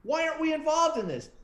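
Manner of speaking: high-pitched indignant outburst, retold; mock outrage at being left out; ballistic boss. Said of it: "my lieutenant goes ballistic".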